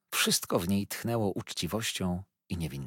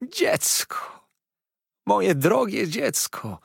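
high, slow, breaking with joy; joyful cry; 11 semitones higher, 3 dB louder, much slower than usual. A narrator's reading. Old nurse crying out in joy to the boy. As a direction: cry out the words to the long-lost boy. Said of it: "krzyknęła".

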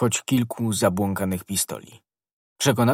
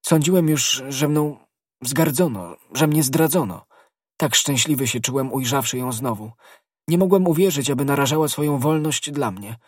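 higher, agitated confession; emotional outpouring; 6 semitones higher than usual. A narrator's reading. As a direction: pour out relief in raised pitch, surging at each new thought. Aramis pours out relief after despair.

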